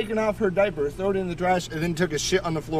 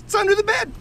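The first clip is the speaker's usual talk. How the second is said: put-on very high, gleeful toddler voice; giddy little daughter; faster than usual. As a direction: imitate a giddy small child. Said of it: "all giddy and happy".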